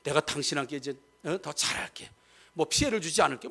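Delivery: soft, low and gentle; quietly earnest to a spouse; sincere promise. Shown as a demonstration. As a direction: promise tenderly to do better. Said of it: "진짜 미안하면".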